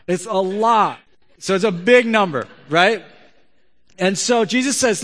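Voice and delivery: pulpit-pounding emphasis; preaching hard. Acted. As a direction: drive the point home to the congregation. Preaching at full force.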